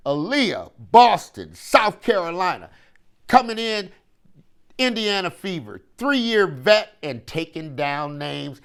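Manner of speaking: arena-style player introduction, rising and loud on her name; hype-man roll call, slowing at the end; announcer intro. Acted.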